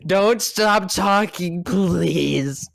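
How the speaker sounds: ordinary talk, nothing marked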